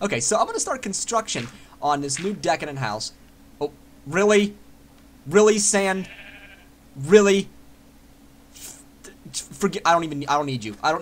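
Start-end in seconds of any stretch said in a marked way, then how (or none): 3.6-7.5 loud, exasperated "really"; hammed-up indignation at a block of sand; mock outrage. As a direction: scold the sand like a betrayer.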